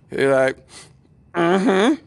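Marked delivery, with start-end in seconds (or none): 1.3-2.0 high, tight nervous hum; imitating a frightened boyfriend; mock terrified assent. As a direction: squeak out a scared agreement. Retold scared boyfriend answers meekly.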